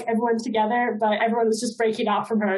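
ordinary talk

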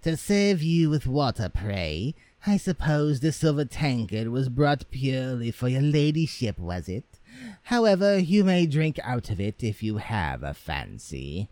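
mock the stranger with haughty scorn; proud and saucy. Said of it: "said the proud, saucy one".

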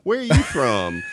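lightly voiced stranger asking about the accent; quoting a curious stranger. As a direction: play the puzzled outsider asking where she's from. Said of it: "I get asked all the time".

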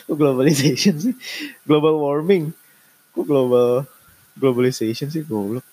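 giggly self-mockery over the wrong word; laughing at himself. Real, not acted. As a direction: laugh through the fix of the slip. Mocks own word mix-up.

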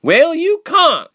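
high, loud, drawn-out yell; bellowing. A narrator's reading. Reshma shutting down Ilse's wish for home, bellowing. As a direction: bellow it, stretching the words. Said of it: "Reshma bellowed".

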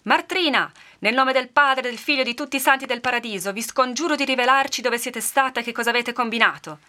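high, loud, urgent adjuration; full-throated cry. A narrator's reading. Woman with rosary confronting a witch, full-throated cry. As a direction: cry it out like an exorcism. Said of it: "gridò a piena gola".

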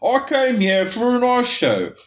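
high, slow mocking voice; mock show visitor. Two knife makers joking, mock snob voice.

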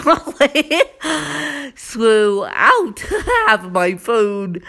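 words broken by laughs; laughing while talking. Real, not acted. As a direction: laugh through the line. Laughs through it.

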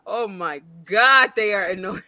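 ordinary delivery

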